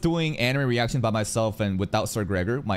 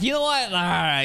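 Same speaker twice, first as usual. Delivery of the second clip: grudging viewer; 8 semitones higher, 3 dB louder than usual. Retold or imitated. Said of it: "they're like".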